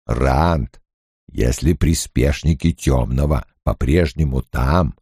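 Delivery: low, rumbling gentle giant; deep Ogier voice. A narrator's reading. Ogier Loyl voiced by narrator.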